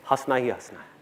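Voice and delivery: low, soft delivery of the line; understated assurance. Real, not acted. Speaker assures audience they will laugh, understated and low. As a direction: drop the voice, matter-of-fact. Understated first take of punchline.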